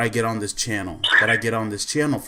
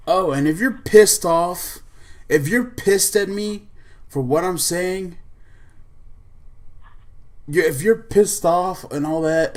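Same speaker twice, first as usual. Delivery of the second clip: angry outburst; 5 semitones higher than usual. Real, not acted. Angry rant at fanboys.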